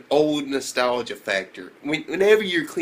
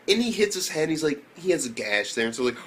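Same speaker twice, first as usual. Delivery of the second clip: ordinary talk, nothing marked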